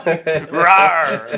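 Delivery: dinosaur roar. Pretend. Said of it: "I'm gonna roar at him".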